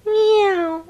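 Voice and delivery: a happy little kitten mew; kitten meow. Acted. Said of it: "The little kitten said".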